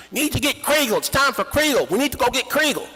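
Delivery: high, loud urgent call; farmer shouting. Retold or imitated. Farmer shouting for Kriegel to be fetched. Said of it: "the farmer starts shouting".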